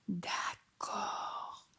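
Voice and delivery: soft breathy whisper. Acted. Dad agrees in a tiny whisper, whispered. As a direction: whisper it so nobody wakes. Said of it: "chuchote papa".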